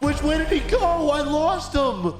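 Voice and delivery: high, agitated exclaiming; frantic chase. Real, not acted. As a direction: shout in frustration mid-chase, pitch way up. Frantic car chase after suspect.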